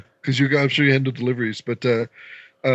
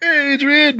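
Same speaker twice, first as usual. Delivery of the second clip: greeting; 14 semitones higher, 4 dB louder than usual. Retold or imitated. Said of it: "And I was like".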